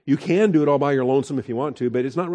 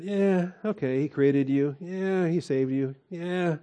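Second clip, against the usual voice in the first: dismissive shrug; 6 semitones higher, slower than usual. Retold or imitated. Voicing someone who slights God.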